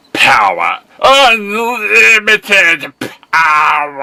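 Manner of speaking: high, loud, drawn-out villain cry; booming villain shout. Acted. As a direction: roar it like a mad emperor.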